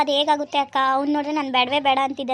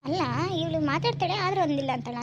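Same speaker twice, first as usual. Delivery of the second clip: normal talking voice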